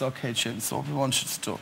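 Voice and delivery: low, very soft director's voice; hushed, low-pitched instructions to an actor; commercial director impression. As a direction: imitate an understated casting director. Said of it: "And he spoke like this".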